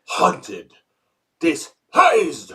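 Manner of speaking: thick Hungarian accent, lightly voiced; dramatic old-country horror star accent; Bela Lugosi accent. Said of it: "the Bela accent was very thick and distinct".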